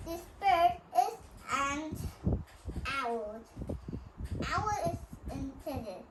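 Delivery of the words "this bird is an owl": ordinary talk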